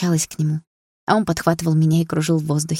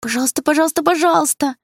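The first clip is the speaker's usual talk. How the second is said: playful begging; 10 semitones higher than usual. Acted. Little sister begging her brother to tell, wheedling. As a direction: beg like a pestering kid.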